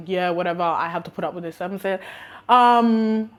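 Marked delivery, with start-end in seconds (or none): 0.1-1.6 low, softer mock resignation; resigned inner voice. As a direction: think it to yourself, fed up. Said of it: "I'm just like".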